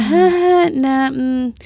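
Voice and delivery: awkward hesitation. Retold or imitated. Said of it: "And I'm like".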